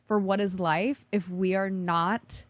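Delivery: ordinary talk, nothing marked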